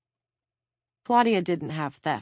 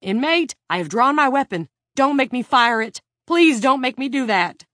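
high, loud pleading command; urgent warning; 8 semitones higher, 8 dB louder than usual. A narrator's reading. Officer with drawn weapon to attacking inmate, urgent warning. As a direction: shout a warning that turns to pleading.

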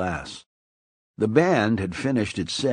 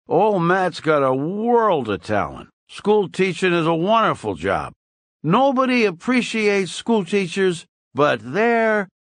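put-on higher, loud tipsy old barfly; drunk Weasel; 9 semitones higher, 3 dB louder than usual. A narrator's reading. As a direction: toast his friend like a swaying drunk, pitched up. Narrator voices drunk Weasel.

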